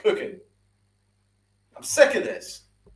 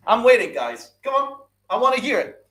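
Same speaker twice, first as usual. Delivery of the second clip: ordinary talk, nothing marked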